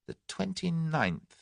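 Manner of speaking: Tommy musing softly to his captors; thoughtfully. A narrator's reading. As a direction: weigh each word quietly. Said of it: "he said thoughtfully".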